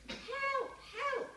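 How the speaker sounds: high, loud panicked cry; cry for help. Acted. The monkey calls for help from the river, panicked. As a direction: call out for help while drowning.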